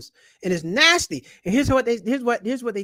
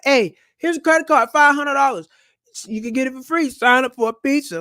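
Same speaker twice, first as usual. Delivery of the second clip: campus card promoter; 5 semitones higher, 6 dB louder than usual. Retold or imitated.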